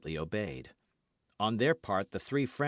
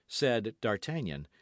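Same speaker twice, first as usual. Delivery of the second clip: usual speaking voice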